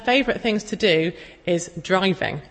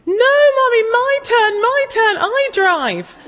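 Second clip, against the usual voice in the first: put-on high, loud, insistent little-child voice; toddler demanding; 14 semitones higher, 6 dB louder, slower than usual. Mum imitating her toddler demanding to drive, high and loud. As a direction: squeal it like a two-year-old who wants the wheel.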